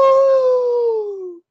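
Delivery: high wolf howl. Acted. Scream or howl coming.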